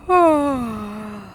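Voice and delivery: a long loud sighing yawn; drowsy drawn-out yawn; big sleepy yawn. A narrator's reading. Bunny yawns in story.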